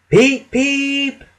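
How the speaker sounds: high, loud whistle peep; engine whistle call. A narrator's reading. Engine greeting a passing train with a high whistle peep. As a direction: toot it like a cheerful little engine. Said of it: "called Thomas".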